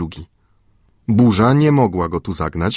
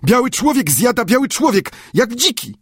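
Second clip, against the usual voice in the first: high, excited native servant voice; high-pitched broken speech of a servant; Friday's voice; 14 semitones higher than usual. A narrator's reading. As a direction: speak up high and alarmed like a frightened islander.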